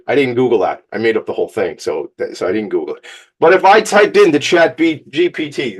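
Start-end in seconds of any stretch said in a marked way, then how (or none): 3.4-5.8 loud excited. Real, not acted. Excited rant about Chat GPT.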